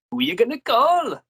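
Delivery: high, loud bad Welsh accent; a clumsy go at a Welsh accent. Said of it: "So that was a bad Welsh accent".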